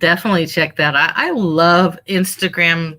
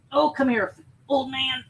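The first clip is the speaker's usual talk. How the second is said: affectionate dog talk; 6 semitones higher, 5 dB quieter than usual. Real, not acted. Greeting her old dog.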